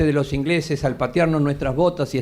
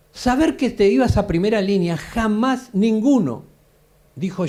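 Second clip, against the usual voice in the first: ordinary talk, nothing marked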